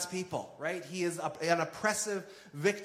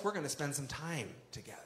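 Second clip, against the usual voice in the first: tender whisper; 3 semitones lower, 9 dB quieter, faster than usual. Retold or imitated. Jesus quoted inviting Zacchaeus.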